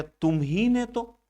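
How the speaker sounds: slow, stress on ने; emphatic. Shown as a demonstration. Teacher accuses a pupil, stressed. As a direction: drag out तुम ही ने तो, accusing. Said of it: "अब आ गया".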